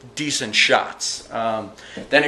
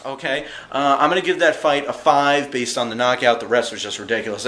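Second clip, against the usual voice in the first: ordinary talk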